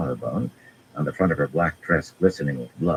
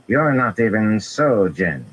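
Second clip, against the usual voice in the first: scornful; 6 semitones higher, 6 dB louder, slower than usual. A narrator's reading. Felendra scorning Semirhage.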